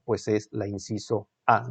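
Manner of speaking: normal talking voice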